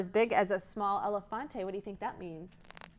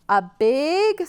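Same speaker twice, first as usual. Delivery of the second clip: ordinary delivery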